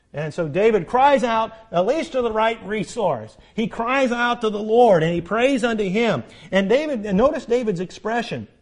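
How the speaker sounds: rising sermon fervour, building on each phrase; fervent preaching. Acted. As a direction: drive it home from the pulpit. Preaching at full force.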